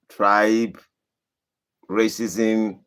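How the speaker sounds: usual speaking voice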